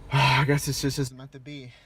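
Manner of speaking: loud, defeated give-up; loud resigned. Real, not acted. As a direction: declare it hopeless, loudly. Disappointed, said loud.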